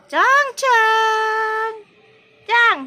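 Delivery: high, loud, drawn-out name call; real high-pitched holler. Real, not acted. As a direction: call the dog from afar. Calling the dog by name.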